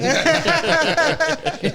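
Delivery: high-pitched burst of laughter; real squealing laugh; cracking up, very high. Real, not acted. Friends laughing at impression.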